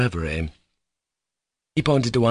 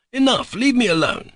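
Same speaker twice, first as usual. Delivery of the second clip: sudden high cry of enough; anguished cry; 14 semitones higher, 3 dB louder than usual. Acted. Mitya cuts off the investigators with a high cry. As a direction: break off the defence with a high cry. Outburst ending monologue.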